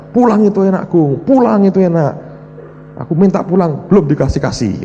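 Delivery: high, loud mock of the pastor's sing-song; a cheeky impression of Pak Yusak; imitating Pak Yusak. Preacher imitates Pak Yusak telling the sick to go home. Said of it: "diomongi Pak Yusak".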